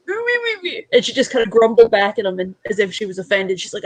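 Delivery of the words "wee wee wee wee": high, whiny dog noises; dog's grumble. Retold or imitated. Owner imitating her dog grumbling back at the boyfriend. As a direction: whine like an offended dog. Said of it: "And she's just like".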